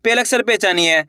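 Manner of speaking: normal talking voice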